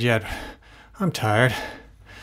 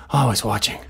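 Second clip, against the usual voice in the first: ordinary talk, nothing marked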